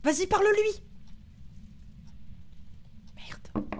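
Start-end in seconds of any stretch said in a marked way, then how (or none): 3.1-3.7 whispered curse. Real, not acted. Whispered curse during hunt.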